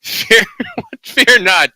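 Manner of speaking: cracking up mid-line, voice jumping high; laughing. Real, not acted. Reading the undead lord's line to friends, real laughter. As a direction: break into laughter on fear. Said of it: "Oh, God".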